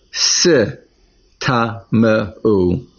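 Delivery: "si ta me u" sounded out slowly; syllable by syllable. Shown as a demonstration. Teacher contrasts the second verse's form for students, slow syllables. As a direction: break "si ta me u" into slow separate syllables. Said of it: "and in Mem Daled".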